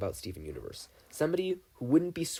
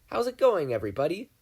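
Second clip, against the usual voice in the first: higher, louder bright hello; cheery greeting; 6 semitones higher, 5 dB louder than usual. Acted. Steven greets everybody on the broadcast, higher and louder. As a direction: sing out a friendly greeting to the crowd.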